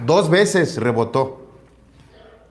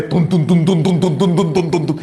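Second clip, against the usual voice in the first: pounding heartbeat. Retold or imitated. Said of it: "mi corazón".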